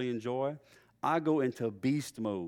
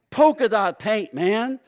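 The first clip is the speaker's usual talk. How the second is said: loud, slower, higher announcement; triumphant reveal; 7 semitones higher, 9 dB louder, slower than usual. Retold or imitated. Crew lead showing off the sprayed can, loud slow reveal. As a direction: proudly present the joke. Spraying dots, presenting the paint.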